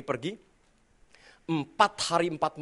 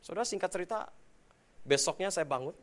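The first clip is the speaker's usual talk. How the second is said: normal talking voice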